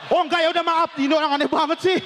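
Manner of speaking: high, sustained indignant voice; mock high-toned outburst. Flirting Bima man turned down, mock indignant high tone. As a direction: burst out at a failed flirt. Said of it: "nadanya tinggi banget gitu".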